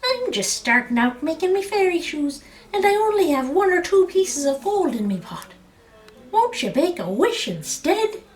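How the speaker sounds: high, coaxing little voice; wheedling leprechaun. A narrator's reading. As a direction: sweet-talk him into a wish. Leprechaun bargains.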